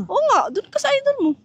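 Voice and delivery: high, loud jealous snap; sulky partner raising the voice high; jealous outburst. Real, not acted. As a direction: lash out jealously, pitch jumping up. Jealous quarrel over phone wallpaper.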